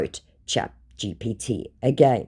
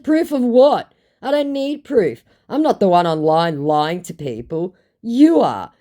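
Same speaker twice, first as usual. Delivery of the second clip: higher and louder, scolding; indignant retort; 6 semitones higher, 6 dB louder than usual. Retold or imitated. Calling out a lying scammer, indignant. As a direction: throw it back at him.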